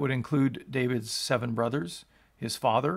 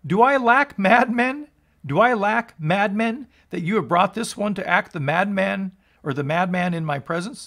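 indignant king; 7 semitones higher, 7 dB louder than usual. Acted. King Achish rebukes his servants.

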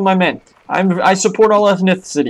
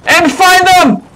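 heroic shout; 9 semitones higher than usual. Pretend. Heroic declaration in battle.

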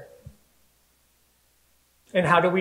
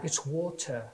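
"water" said the English way, "waw-tuh"; English-accented correction on "water"; imitated English accent; 2 semitones lower, 10 dB quieter than usual. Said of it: "I'm not even gonna try to do an English accent".

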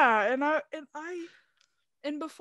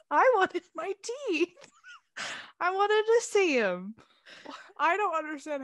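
petulant whine; 7 semitones higher, 3 dB louder than usual. Retold or imitated. Whining about teeth, laughing.

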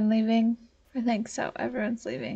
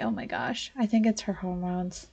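usual speaking voice

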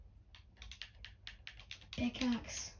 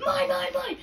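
excited grabby shout; 9 semitones higher than usual. Real, not acted. Grabbing the god hoe.

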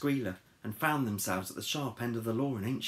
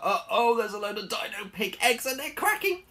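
high, loud, building excitement; alarmed narration; 12 semitones higher, 6 dB louder than usual. A narrator's reading. Warning listeners about hatching Dino Pig eggs, rising alarm. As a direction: narrate as danger breaks out.

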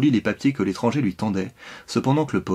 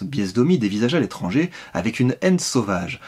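ordinary delivery, nothing marked

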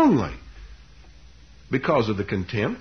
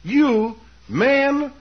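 slow, loud and high, word by word; hammed-up sarcastic salutation; sarcastic address; 10 semitones higher, 5 dB louder, much slower than usual. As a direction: draw out each word in mock reverence. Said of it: "The sarcasm is very implicit".

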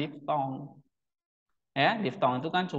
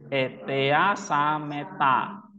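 Etea sameta drawn out very slowly, syllable by syllable; slow reading demo; much slower than usual. Shown as a demonstration. Teacher modelling Greek syllable reading, very slow. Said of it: "Coba baca".

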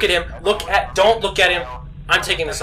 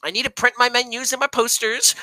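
ordinary talk

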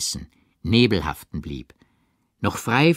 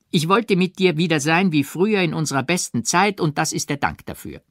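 angry reproach; 8 semitones higher than usual. A narrator's reading. Odette's angry outburst.

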